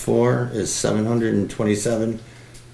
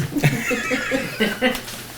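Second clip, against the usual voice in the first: much higher falling laugh. Real, not acted. Superintendent laughing at the chair's teasing, high and falling. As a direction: laugh hard and let it fall away.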